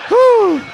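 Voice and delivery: high hammed-up sigh of relief; mock whew. Comic telling his dog-scare story, a hammed-up relieved whew. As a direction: whistle out a big fake phew.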